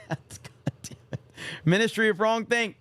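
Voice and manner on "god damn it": breathy, slow whispered curse; exasperated whisper. Real, not acted. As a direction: sigh the curse out under your breath. Reacting to an offensive chat message.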